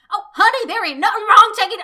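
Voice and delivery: put-on loud, high-pitched Southern accent. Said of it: "that accent is coming out a lot at me".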